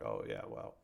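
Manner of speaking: low, muttered imagined reply; quietly chide, as an imagined critic; soft, low pretend scolding; mock chiding. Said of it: "they would be like".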